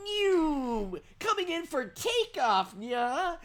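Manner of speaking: high, slow, cutesy plane-cat voice; squeaky cartoon cat taking off; playful high cat imitation. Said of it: "he's just going".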